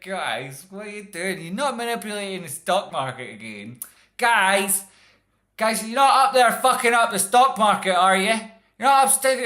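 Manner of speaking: high, very loud parental yell up the stairs; scolding parent. Retold or imitated. Parent yelling upstairs at kids wrecking the stock market, high loud scold. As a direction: bellow like a mum catching the kids at it. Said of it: "That's what it's like, parents all across the world".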